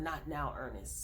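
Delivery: retold whisper. Aunt hushing the man, a soft whispered warning. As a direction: drop to a hush as if not to be overheard. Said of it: "she whispered".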